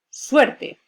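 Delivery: Suerte said high and loud, leaning on the E; stressed model word. Shown as a demonstration. Teacher modelling the word for students, loud stressed demo. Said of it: "La vocal tónica es la E".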